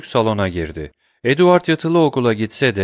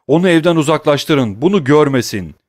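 loud high calls of the name; shouting; 6 semitones higher, 6 dB louder than usual. Acted. Father yelling his son's name through the house, loud. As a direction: yell Edward down the hall. Panicked orders to servants.

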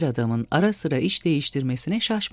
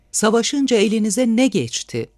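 yüksek perdeden, gür sesle hesap soran hatip; kalabalığa seslenir gibi tiz ve yüksek sesle; bağırma; 7 semitones higher, 5 dB louder than usual. A narrator's reading. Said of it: "diye bağırıyordu adam". (yüksek sesle bağırarak sorma)

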